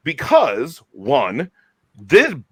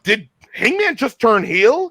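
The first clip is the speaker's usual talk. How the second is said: surprised question; 8 semitones higher than usual. Real, not acted. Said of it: "I'm like".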